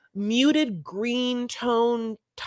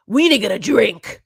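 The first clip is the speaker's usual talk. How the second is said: louder, mock urgent; mock urgent demand; 7 dB louder than usual. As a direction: blurt it out like a man who needs a drink. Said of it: "is what he literally says".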